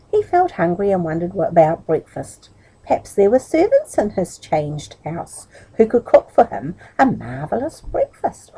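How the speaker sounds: plain reading voice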